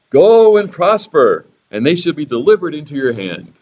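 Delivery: drip sarcasm over the blessing; sarcastic. Acted. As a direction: prophet mocking the king's wish, sarcastic. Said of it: "sarcasm Bible".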